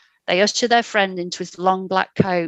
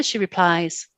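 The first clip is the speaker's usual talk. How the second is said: ordinary delivery, nothing marked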